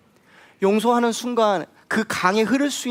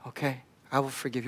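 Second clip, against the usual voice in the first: much softer, giving in; a reluctant, hushed yes; quiet surrender; 6 semitones lower, 10 dB quieter than usual. Retold or imitated. Woman finally agreeing to forgive, hushed.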